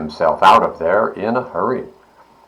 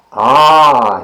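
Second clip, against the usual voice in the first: louder, higher sigh of relief; contented ah; 5 semitones higher, 6 dB louder than usual. A narrator's reading. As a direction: sigh with pleasure. Pig finds mud at last.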